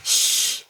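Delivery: hard unvoiced shh; nanny's loud shush. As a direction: shush him firmly. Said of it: "shushed Nanny".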